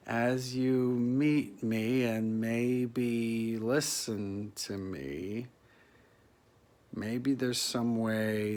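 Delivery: very slow, earnest plea; slow pleading. Real, not acted. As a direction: stretch each word out as you beg. Pleading for acting's rescue.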